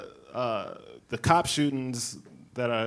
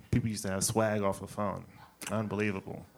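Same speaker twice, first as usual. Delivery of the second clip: ordinary delivery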